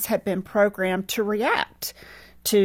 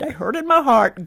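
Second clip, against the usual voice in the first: normal talking voice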